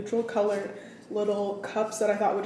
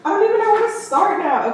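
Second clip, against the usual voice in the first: high, exasperated and a bit louder; flustered; 10 semitones higher, 8 dB louder than usual. Real, not acted. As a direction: throw up your hands, overwhelmed. Flustered restart after dropping camera.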